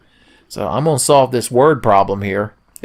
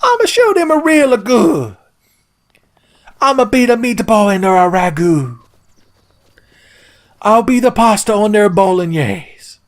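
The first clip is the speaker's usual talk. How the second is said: very high, hammed-up Italian accent; Miyamoto; 12 semitones higher than usual. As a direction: rant like an angry cartoon Italian. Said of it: "Like how he became Italian".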